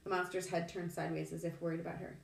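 ordinary delivery

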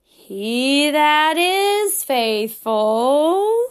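slow, drawn-out scripture recitation; very slowly. Shown as a demonstration. Teacher reciting scripture slowly for kids. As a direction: stretch each word like a turtle. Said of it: "Say it so slowly".

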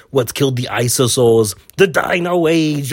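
botched Mr. Freeze line in a booming Arnold voice; Arnold Schwarzenegger. Retold or imitated. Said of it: "I always loved it much better doing it".

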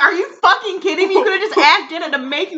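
loud, high incredulous rant; hammed-up fed-up scolding; mock outrage at a nacho trip; mock exasperation. Acted exasperated Eleanor.

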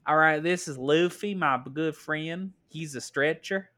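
Southern belle introducing a friend; Yamato. Acted. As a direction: present him in a Southern accent. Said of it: "It's just Southern Belle".

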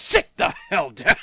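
mock yell, breaking into laughter; retold scream. Retelling the actress's outburst to a co-host, mock scream. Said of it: "just starts screaming at her".

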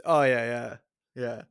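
ordinary delivery

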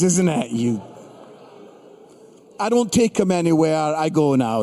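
ordinary delivery

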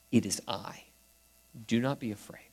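gentle reassurance. Acted. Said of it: "That's another way of saying".

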